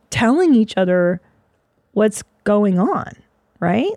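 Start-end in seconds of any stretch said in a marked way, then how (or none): none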